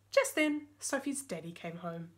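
plain reading voice

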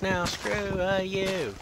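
high, loud yelled insult; mock yell. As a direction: shout it up at the tree. Yelling insult at a tree.

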